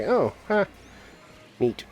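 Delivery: half-joking delight at a gadget; mock impressed. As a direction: play it faux-impressed. Mock reaction to reminder app.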